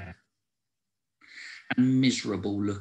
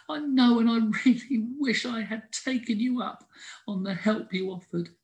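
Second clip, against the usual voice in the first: higher, small squirrel voice; sheepish squirrel; 11 semitones higher than usual. A narrator's reading. Squirrel admitting to Howard he needed help, sheepish. Sorry squirrel answers friend.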